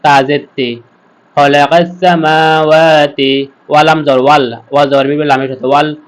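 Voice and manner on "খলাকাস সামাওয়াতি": slow recitation, long vowels in সামাওয়াতি; এক আলিফ টান. Shown as a demonstration. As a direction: stretch MAA and WAA in সামাওয়াতি. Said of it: "এক আলিফ টেনে পড়বো". (সামাওয়াতি টেনে টেনে পড়া)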